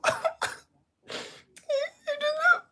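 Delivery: high whiny mock sobbing; fake crying. Showing how the girls turn on tears, mock sobbing. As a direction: put on a pathetic fake cry, voice way up. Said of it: "I will cry".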